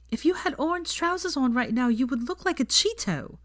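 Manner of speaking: lightly voiced girl mocking; Hermione voice. Hermione's joke voiced higher.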